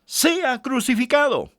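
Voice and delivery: high, slow mock crowd cry; crowd shouting. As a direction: cry it out high and drawn out like the mob. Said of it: "ellos gritaban aún más".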